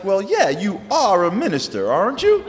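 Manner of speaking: chirpy caller assuming the obvious; eager Southern lady. Retold or imitated. Caller asks if he is a minister, eager Southern lady.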